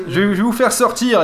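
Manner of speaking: giggling robot reassurance; cheery half-laughed promise; Wheatley voice. Retold or imitated.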